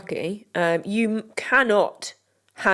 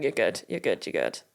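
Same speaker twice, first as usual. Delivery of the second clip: softer and much lower each time, soothing; calm her down, sinking lower; reassuring; 14 semitones lower, 7 dB quieter than usual. Real, not acted. Reassuring friend, descending repeats.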